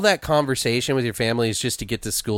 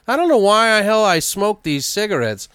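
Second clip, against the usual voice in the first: dad grumbling; 10 semitones higher, 5 dB louder than usual. Retold or imitated. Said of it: "And then he's like".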